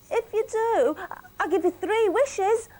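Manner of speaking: high, bright fish voice offering wishes; magic fish. Acted. Magic fish offering Millie three wishes, high voice. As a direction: squeak it like a tiny magic fish.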